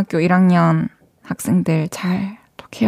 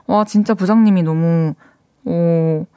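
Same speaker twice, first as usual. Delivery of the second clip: normal talking voice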